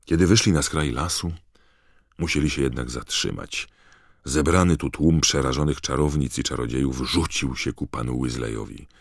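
plain reading voice

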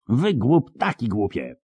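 high, angry outburst; high-pitched angry yelp; shrieked scolding. A narrator's reading. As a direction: scold two brawlers in a high cry. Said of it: "wrzasnęła z trzaskiem".

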